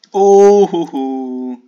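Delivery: loud high oh, hoo hoo; real startled hoot; excited whoop. Real, not acted. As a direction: whoop at a close escape. Excited exclamation.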